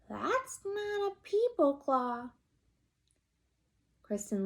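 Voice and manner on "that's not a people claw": slow and much higher; rising alarm. Acted. Kristen finding a claw, slow and high. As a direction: say it slowly, voice climbing. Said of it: "and said".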